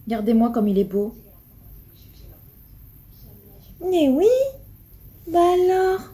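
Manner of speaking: high cooing to a pet. Real, not acted. Doting owner cooing to her pet. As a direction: coo lovingly at the little one.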